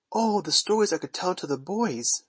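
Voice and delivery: high, eager exclamation; eager cry. A narrator's reading. Wendy tempts Peter with stories, eager cry. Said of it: "she cried".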